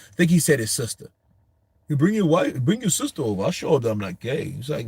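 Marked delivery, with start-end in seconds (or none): 1.9-4.5 lightly acted angry challenge; pissed-off boxer. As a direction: imitate an indignant comeback, pitched high. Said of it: "he got pissed".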